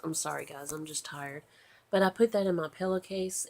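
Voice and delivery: ordinary talk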